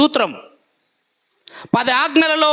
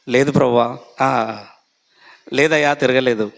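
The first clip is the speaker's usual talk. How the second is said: Peter denying; 14 semitones lower than usual. Acted. Peter denying to the servant girl, low and evasive.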